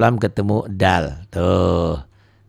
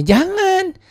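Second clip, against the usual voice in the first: squeaky high Jangan; high mock cry. Teacher playing a scared character in an example. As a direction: yelp it like a startled child. Acted dialogue about a thorn.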